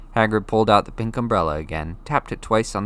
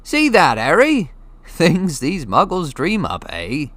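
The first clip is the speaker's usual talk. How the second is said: booming, louder and higher; calling out across the street; loud giant Hagrid; 9 semitones higher, 8 dB louder, slower than usual. A narrator's reading. Hagrid pointing out parking meters to Harry, loudly. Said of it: "saying loudly".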